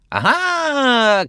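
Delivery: high, loud cry over the crowd; shout over the roar. A narrator's reading. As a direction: shout to top a roaring crowd. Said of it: "крикнул он, стараясь перекричать рёв". (крик поверх рёва)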